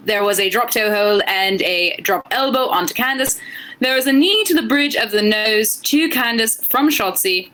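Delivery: British accent. Shown as a demonstration. Co-host recapping a match on a dare, British accent. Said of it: "give us your English accent".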